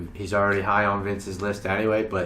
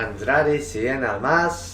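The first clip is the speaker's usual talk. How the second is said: mock Spanish accent; much slower than usual. Mocking Spanish name pronunciation.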